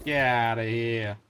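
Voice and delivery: put-on slow, loud New England accent; broad New England "get outta here". Gamer mocks a New England accent, slow and loud. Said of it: "Sorry if anybody is from the Nor-, New England area".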